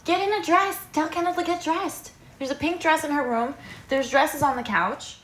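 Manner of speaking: high-pitched, hurried instruction; calling out orders. Real, not acted. Mentor telling girls to get dressed, calling out. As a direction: call through the house to get them moving.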